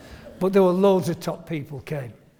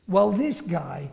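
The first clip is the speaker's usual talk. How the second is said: usual speaking voice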